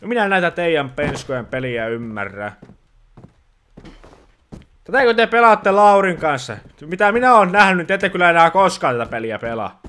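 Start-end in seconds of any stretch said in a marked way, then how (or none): 4.8-9.8 loud, high telling-off; stern scolding. Real, not acted. Grandfather forbids Pete and Lauri the game, stern scolding.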